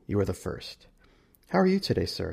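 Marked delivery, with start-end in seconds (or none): 1.5-2.3 high-pitched smiling greeting; warm and friendly. A narrator's reading. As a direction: greet a guest with an audible smile. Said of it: "she says with a warm and friendly smile".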